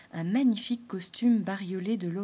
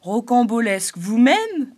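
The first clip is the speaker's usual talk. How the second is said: much louder, slow offended snap; sulky retort; 3 semitones higher, 12 dB louder than usual. Acted. Springtail scowling back at the clown, loud retort. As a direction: throw the word back at him. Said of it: "rétorqua Arcan la mine renfrognée".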